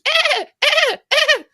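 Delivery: high shrieking laugh; wild high laugh; burst of laughter. Real, not acted. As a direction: cackle out loud. Hosts cracking up at clip.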